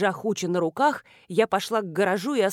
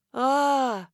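plain reading voice

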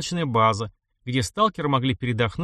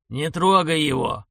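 slow shouted warning; 4 semitones higher, slower than usual. Acted. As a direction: yell it slowly as a warning. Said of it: "заорал за спиной дед".

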